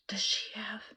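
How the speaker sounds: hushed whisper; husband whispering at the front desk. Retold or imitated. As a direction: whisper it so she can't hear. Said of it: "I'm whispering".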